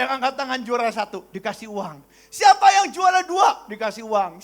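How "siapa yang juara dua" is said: loud, high, drawn-out call; grandmother's announcement. Retold or imitated. Grandma calling her grandchildren for prize money, shouting. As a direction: shout it out slowly to the whole group. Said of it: "emak akan bilang".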